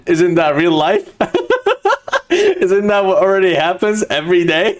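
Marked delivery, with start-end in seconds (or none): 1.2-4.8 amused, high laughter through the words; can barely ask it for laughing. Real, not acted. Laughing at show resembling real life.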